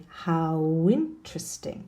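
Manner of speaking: slow "How interesting" with a w gliding into interesting; linked W sound. Shown as a demonstration. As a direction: draw out How into a w before interesting. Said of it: "interesting really becomes winteresting".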